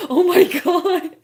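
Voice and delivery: loud, high laughing exclamation. Real, not acted. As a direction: burst out laughing at the idea. Laughing at a great idea.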